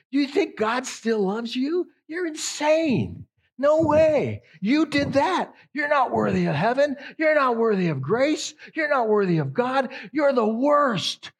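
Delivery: high, loud sneering accuser's voice; accusing devil. Retold or imitated. Preacher voicing Satan's accusations, high and sneering. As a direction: taunt them like the accuser himself.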